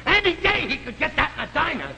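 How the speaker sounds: high-pitched, indignant dismissal; exasperated, pitched well up; scornful complaint. Acted. Husband scorns the planned dinner.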